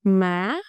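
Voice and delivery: "Má" sweeping up in pitch; rising tone. Shown as a demonstration. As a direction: raise "Má" quickly at the end. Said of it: "The pitch go up quickly".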